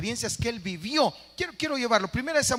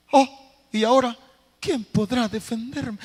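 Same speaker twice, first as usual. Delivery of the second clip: helpless cry; 4 semitones higher, 6 dB louder than usual. Retold or imitated. Cornered person crying out helplessly.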